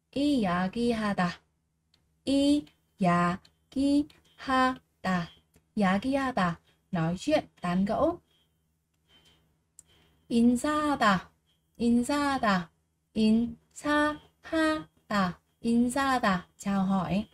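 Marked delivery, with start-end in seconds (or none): 2.2-3.4 이야기하다 split as 이-야-기-하-다 with gaps; syllable by syllable. Shown as a demonstration. Teacher drilling learners on 이야기하다, every syllable separated. 13.1-14.2 인사하다 split as 인-사-하-다 with gaps; syllable by syllable. Shown as a demonstration. Teacher drilling learners on 인사하다, every syllable separated.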